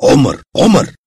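loud startled shout of a name; terrified cry. A narrator's reading. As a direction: wake from a nightmare calling out. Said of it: "أفاق مفزوعا وهو يقول". (صرخة فزع)

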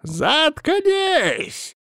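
high, harsh shout of shut up; snapping back. A narrator's reading. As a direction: cut her off with a shriek. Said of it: "ответил он в ответ".

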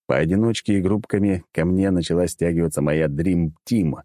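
ordinary delivery

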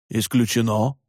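low, curt dismissal; curt refusal. A narrator's reading. Head doctor rejects Tuomas's request, curt refusal. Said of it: "отрезал заведующий".

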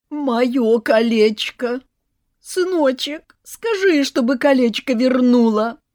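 high, loud hammed-up whimpering plea; mother pretending to cry for ring; fake sobbing. A narrator's reading. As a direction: fake a sob to the son. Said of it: "притворно всхлипывала мать".

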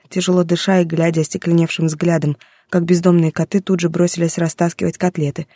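usual speaking voice

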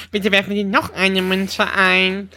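slow robotic automat voice; machine announcement voice. Retold or imitated. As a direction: read it like a jukebox machine. Imitating slot machine voice. (langsame Automatenstimme)

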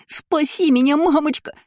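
put-on high, whiny plea of a hysterical boy; hysterical wail. A narrator's reading. Boy begs mother to save him, hysterical. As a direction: plead shrilly in mock hysterics. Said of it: "захлебывался истерикой Лайер".

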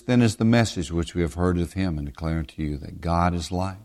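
scripture reading. Acted. Preacher reading scripture to his listeners, measured. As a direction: read the verse out to the congregation.

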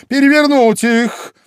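high, loud royal order; angry command. A narrator's reading. Queen orders the knave about, angry shout. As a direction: order it sharply at the top of the voice. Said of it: "бросила на него гневный взгляд и приказала".

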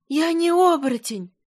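high delirious cry; sudden cry. Acted. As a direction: cry out in a fever. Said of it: "Вдруг вскрикнула горлинка".

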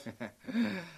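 quiet amused chuckle; soft mocking laugh. Villain laughing off her threat to kill him, soft mocking chuckle.

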